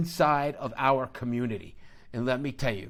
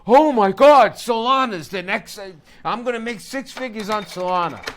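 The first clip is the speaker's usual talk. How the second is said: shrill-free mock hype, much louder and higher; mocking excited schmuck; 7 semitones higher, 13 dB louder than usual. Mocking the schmuck YouTubers who pile into Solana, loud hammed-up hype. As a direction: gush like a naive YouTuber who thinks he'll get rich. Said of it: "all the schmuck YouTubers started to realize".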